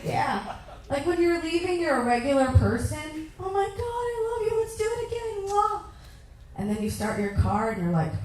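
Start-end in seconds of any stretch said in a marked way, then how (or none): 3.4-5.8 high, sing-song sweet gushing; much higher, lilting lovey-dovey voice with a kiss; gushing sweetheart. Acted. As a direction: coo it like a doting lover. Gushing as a regular person leaving.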